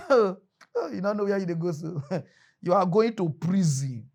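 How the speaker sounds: normal talking voice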